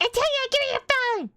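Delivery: high, fast exclaimed order; mock parental scolding. Parent scolding retold in story.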